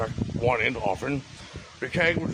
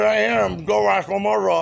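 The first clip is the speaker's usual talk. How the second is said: indignant reply; 7 semitones higher, 5 dB louder than usual. Retold or imitated. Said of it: "I said".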